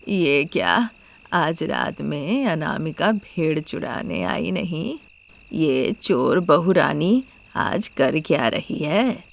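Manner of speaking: puzzled village man. Acted. Mallesh waits alone at night, puzzled and low. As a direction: muse to yourself, low and slow. Watchman voices his puzzlement.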